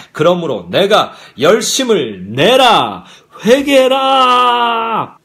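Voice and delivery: slow, drawn-out thundering command; commanding shout. Shown as a demonstration. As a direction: call it out loud, stretching the last syllables. Preacher thunders the verse's command.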